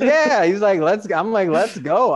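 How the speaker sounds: high, bright-pitched eagerness; eager. Retold or imitated. Urging his client to go see the house, eager. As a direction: push to go right now.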